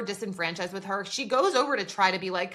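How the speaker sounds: normal talking voice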